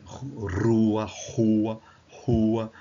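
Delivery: "rua" firmly, from one R variant to the next; regional R variants. Shown as a demonstration. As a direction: demonstrate "rua" with different Brazilian R sounds. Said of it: "Tem diversas, dependendo, a depender do sotaque".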